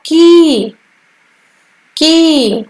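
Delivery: की with the long ee held out. Shown as a demonstration. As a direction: stretch the vowel in की long. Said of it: "इसको बोलने में अधिक समय लगता है की". (लंबी ई के साथ की)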